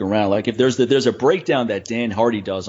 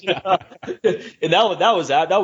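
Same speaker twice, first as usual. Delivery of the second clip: laughing; 8 semitones higher than usual. Real, not acted. Laughs after Nate quote.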